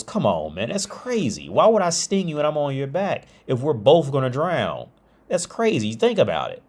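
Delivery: animated mock-offended persuasion; persuading scorpion. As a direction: talk the frog round, acting indignant. Scorpion coaxing the doubtful frog.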